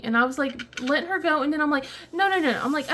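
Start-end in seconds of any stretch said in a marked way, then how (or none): 2.1-2.6 quick higher no no no; hurried, higher protest; urgent no. Retold or imitated. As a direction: stop her before she runs off. Stops daughter from leaving.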